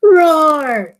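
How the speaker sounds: loud high mock dragon roar. Dragon roaring in battle scene.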